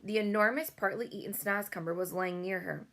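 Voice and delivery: ordinary delivery, nothing marked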